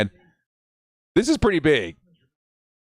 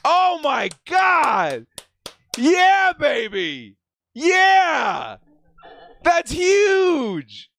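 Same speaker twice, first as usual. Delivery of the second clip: higher, slow, shouted disbelief; stunned excitement; 8 semitones higher, much slower than usual. Real, not acted. Fan reacting live to a draft steal, shouting in delight. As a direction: let the joy burst out in shouts.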